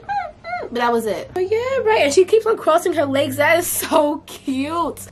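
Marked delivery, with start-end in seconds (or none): 0.0-0.7 high, soft imitated puppy whimper; tiny high whimper like a crying puppy. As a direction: mimic the puppy's little cry, softly. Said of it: "She was like".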